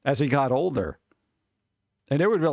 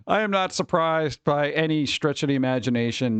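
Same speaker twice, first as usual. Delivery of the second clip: ordinary talk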